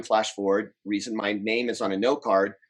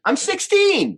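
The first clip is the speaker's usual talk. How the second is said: amazed emphasis; 7 dB louder, much slower than usual. Real, not acted. Stressing how young he was.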